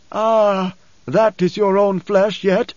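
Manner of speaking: slow, high-pitched sigh of discovery; Paul, relieved. A narrator's reading. Paul touches his son's face.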